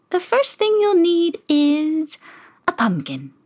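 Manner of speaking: lightly voiced high, slow godmother; a hint of a merry old fairy. Godmother greets Cinderella, lightly high and merry. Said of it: "the woman replied".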